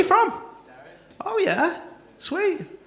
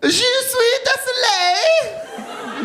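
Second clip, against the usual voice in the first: high, drawn-out mock French wail; hammed-up French; much slower than usual. Comic showing off his French, hammed-up French accent. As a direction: wail it slowly in over-the-top French. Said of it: "I gotta get French, though".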